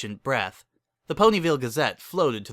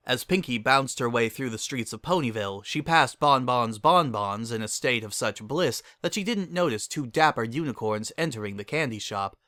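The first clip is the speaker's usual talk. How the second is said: plain reading voice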